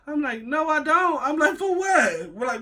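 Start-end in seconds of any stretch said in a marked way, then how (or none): 0.4-1.2 high-pitched mock protest; indignant refusal. Speaker refuses cousin's advice to open up, high indignant protest. 1.6-2.3 high-pitched, incredulous comeback; indignant retort. Retold or imitated. Speaker answers cousin about letting walls down, high indignant retort.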